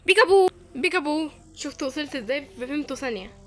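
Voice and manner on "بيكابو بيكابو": بيكابو بيكابو called out loud and higher, quick double; loud playful peekaboo before teleporting. Pretend. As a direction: shout بيكابو twice like a teasing game. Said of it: "اقول لكم بيكابو".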